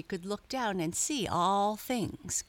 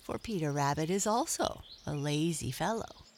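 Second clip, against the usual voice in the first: ordinary delivery, nothing marked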